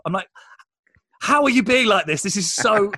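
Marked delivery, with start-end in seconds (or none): 1.2-2.9 higher, louder incredulous protest; retold exasperated disbelief. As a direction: confront a friend in mock exasperation. Retold exasperation at successful friend's self-doubt.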